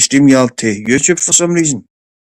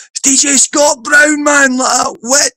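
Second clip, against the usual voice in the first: excited recognition; 12 semitones higher, slower than usual. Retold or imitated. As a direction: shout it out, amazed it's really him. Recognizing a famous DJ, retold.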